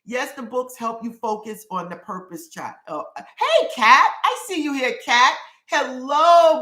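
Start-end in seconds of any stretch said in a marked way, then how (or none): 3.2-6.6 much higher, louder, delighted hello; squealing hello to a loyal follower; excited greeting. Real, not acted. Greeting a regular viewer on stream.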